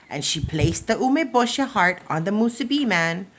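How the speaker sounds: usual speaking voice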